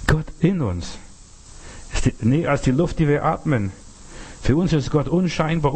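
ordinary talk

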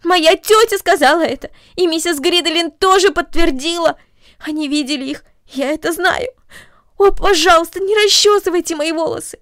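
high, loud, worried begging; a girl clinging to her fear; anxious pleading. A narrator's reading. Girl begs the mother not to comb her hair.

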